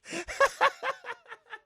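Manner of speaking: very high cracked laugh; real high squeal of laughter. Real, not acted. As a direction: crack up high at your own joke. Laughing at own joke.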